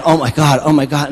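excited loud outburst. Retold or imitated. Telling of thinking about the hot girls, excited. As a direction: blurt it out, loud and eager. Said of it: "I'm very excited".

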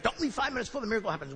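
rattled-off slogan. Retold or imitated.

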